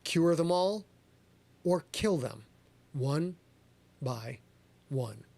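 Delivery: normal talking voice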